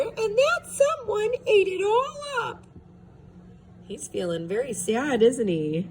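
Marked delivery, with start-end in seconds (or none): 0.0-2.6 very high crying squeak; squeaky Baby Bear. A narrator's reading. Baby Bear finding his porridge gone, high tearful squeak. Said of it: "squeaked Baby Bear".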